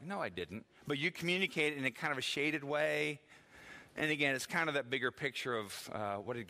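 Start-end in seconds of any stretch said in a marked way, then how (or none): 0.0-0.6 soft, low-key denial; mild, lower-pitched little denial; really soft tone. Shown as a demonstration. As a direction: say it gently and quietly. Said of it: "said it in a really soft tone".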